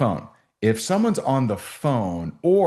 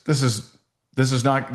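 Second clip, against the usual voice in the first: normal talking voice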